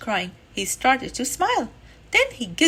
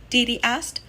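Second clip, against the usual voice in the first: usual speaking voice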